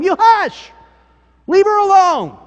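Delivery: high, loud rebuke shouted at the crowd; stern shout. Retold or imitated. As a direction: bark it out to silence them. Jesus rebuking the disciples.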